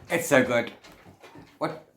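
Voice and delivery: usual speaking voice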